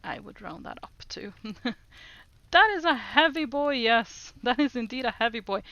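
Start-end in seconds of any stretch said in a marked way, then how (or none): 2.5-5.6 amused exclaim. Real, not acted. Amused at pig's weight.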